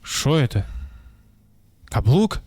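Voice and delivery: normal talking voice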